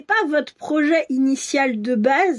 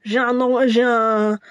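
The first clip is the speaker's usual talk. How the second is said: usual speaking voice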